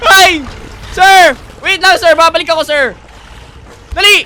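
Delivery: loud frightened yelling; panicked shouting. Real, not acted. Fleeing monster, calling out.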